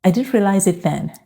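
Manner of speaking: ordinary talk, nothing marked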